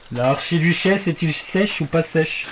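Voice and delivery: slow, careful tongue twister. Shown as a demonstration. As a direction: say it slowly, word by word. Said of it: "Je t'apprendrai à le dire". (virelangue articulé lentement)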